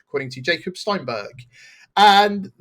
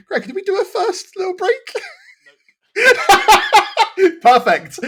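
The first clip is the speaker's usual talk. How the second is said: high, loud, helpless laughter through the words; laughing; 6 dB louder than usual. Real, not acted. Host asks co-host about the first break, laughing. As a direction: crack up while speaking.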